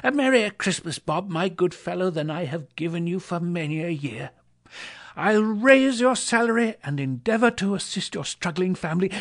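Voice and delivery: high, bubbling, generous delight; an old man overflowing with goodwill; joyful Scrooge. Acted. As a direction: Scrooge jubilantly promising Bob help. Joyful Scrooge promising help.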